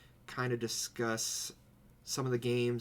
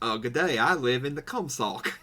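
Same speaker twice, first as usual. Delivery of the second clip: bad Australian accent; 3 semitones higher, 6 dB louder than usual. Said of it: "That wasn't much better".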